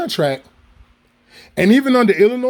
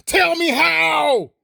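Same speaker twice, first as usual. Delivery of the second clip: raised and slowed right down, each word stretched; slow drawn-out demand; 6 semitones higher, much slower than usual. Real, not acted. Repeating the challenge to viewers, slow stretched shout. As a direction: drag "Tell me how" out slowly and pointedly.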